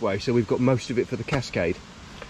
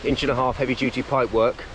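usual speaking voice

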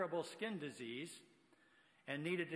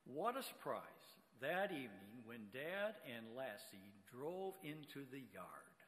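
normal talking voice

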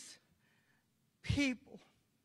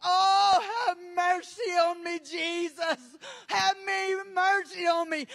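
wailing cry for mercy, loud opening Oh; screamed plea; 4 semitones higher, 7 dB louder than usual. Retold or imitated. Preacher voicing the damned pleading with Jesus, screamed plea. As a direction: cry out as souls in the fire. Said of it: "And they're screaming".

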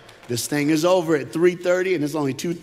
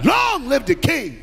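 much higher, slow full shout; bigger shouted call; 9 semitones higher, 3 dB louder, slower than usual. Shown as a demonstration. Preacher calling the room to shout it louder, a full high shout. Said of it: "One more time".

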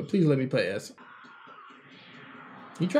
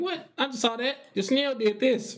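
incredulous mock voice; 9 semitones higher than usual. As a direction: play someone who can't believe it.

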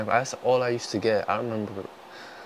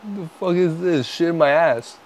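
loud disgust; 7 semitones higher, 6 dB louder than usual. Real, not acted. Outraged disgust at taste.

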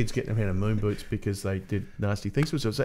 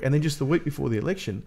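usual speaking voice

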